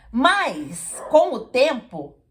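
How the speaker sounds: normal talking voice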